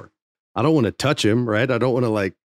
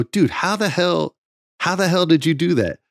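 higher, stretched-out disbelief; amazed; 6 semitones higher than usual. Retold or imitated. Writer to wrestler in hotel room after the show, amazed. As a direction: demand an explanation in amazement.

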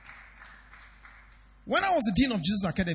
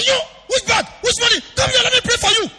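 loud, high fearless reply; shouting back at the armed robber; defiant shout; 16 dB louder than usual. Retold or imitated. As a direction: shout him down, unafraid. Man answers robber defiantly.

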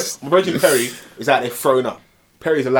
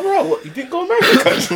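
laughing mock question; 4 dB louder than usual. Mocking a friend with a laugh.

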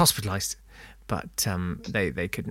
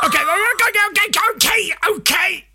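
high, loud mock yelp of okays; much higher, loud panicked okays; pained Houdini; 4 dB louder than usual. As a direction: yelp it out like you've been winded. Houdini in pain after punches.

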